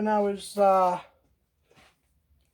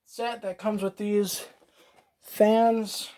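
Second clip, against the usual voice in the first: ordinary talk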